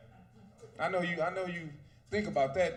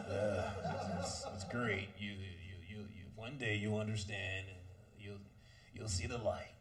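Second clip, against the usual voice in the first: put-on much lower, softer, resigned old husband; weary married guy; 12 semitones lower, 11 dB quieter than usual. Long-married man telling young guys it's worth it, resigned and low. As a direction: sigh it out like a worn-down husband. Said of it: "telling other young guys like that".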